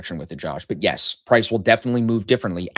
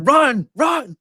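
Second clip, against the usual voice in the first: high, loud playful shout; mock urgent yell; 8 dB louder than usual. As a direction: yell it like a prankster fleeing the doorstep. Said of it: "I'm in the back going".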